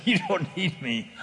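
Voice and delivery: slow words breaking into a laugh; broke out laughing. Retold or imitated. Telling the caller he needs no help, laughing. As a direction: laugh right through the line. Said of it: "I broke out laughing".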